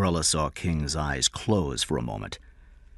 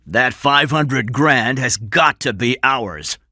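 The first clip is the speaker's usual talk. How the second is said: slow, loud, raised declaration; determined; 7 semitones higher, 7 dB louder, slower than usual. Acted. Crook set on getting the ransom, determined. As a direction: insist on it, weighting each word.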